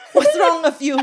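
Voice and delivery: hammed-up motherly demand; imitating mom. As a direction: do mom's catchphrase through laughter. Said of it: "what does mom say?".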